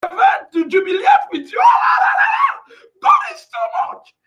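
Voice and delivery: high-pitched jubilant shout with laughter; ecstatic shouting. Real, not acted. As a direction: burst into wild, exultant praise. Ecstatic testimony, shouting praise.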